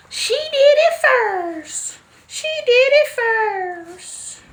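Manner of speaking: high, drawn-out, slow insistence; slow emphatic repeat. Acted. Telling her viewers her sister did it first, slow and high. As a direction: stretch every word out high.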